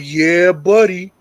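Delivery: put-on slow, drawn-out drunken "Yeah, buddy"; drunk son. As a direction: slur it slowly like a drunk son.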